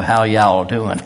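mock cowboy accent; cowboy drawl. Preacher showing how the oil magnate used to talk, cowboy accent. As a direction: greet folks in a cowboy accent. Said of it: "he went talking like a cowboy".

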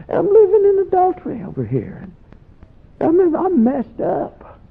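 high, bewildered voice; baffled confession of sin; incredulous confession. Retold or imitated. Friend confessing, laughing disbelief.

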